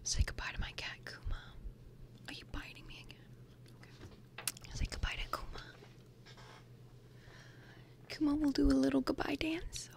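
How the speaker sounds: soft breathy whisper, close to the mic; ASMR whisper. Real, not acted. Vlogger whispering through her bag contents, ASMR style. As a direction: whisper like sharing secrets with a friend.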